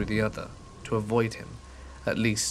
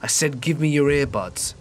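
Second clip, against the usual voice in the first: louder, higher insistent demand; 4 semitones higher, 5 dB louder than usual. Retold or imitated. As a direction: push the repeat harder. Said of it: "his voice was louder".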